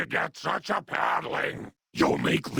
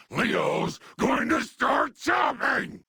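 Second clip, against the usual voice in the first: high, slow threatening cry; high-pitched menacing warning; shouted threat; 11 semitones higher, slower than usual. Acted.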